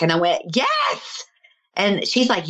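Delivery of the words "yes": very high-pitched exclamation; retold high-pitched yes of recognition; emphatic agreement. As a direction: squeal it in excited agreement. Acted reply to mother's realization.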